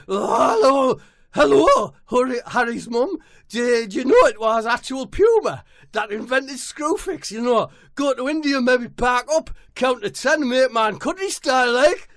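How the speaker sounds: high, loud scouse footballer voice; high-pitched footballer caricature; Jordan Henderson voice. Acted. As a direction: squeal it like an overexcited footballer.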